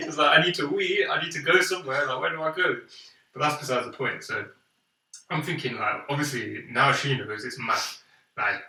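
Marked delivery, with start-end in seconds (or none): 0.4-2.8 higher-pitched comic desperation; mock urgency. Kid needing the blocked toilet, mock desperate. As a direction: imitate a kid who can't hold it. Retold dilemma needing a wee.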